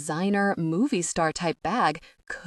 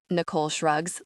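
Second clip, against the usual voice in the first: ordinary delivery, nothing marked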